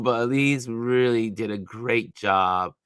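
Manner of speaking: ordinary talk, nothing marked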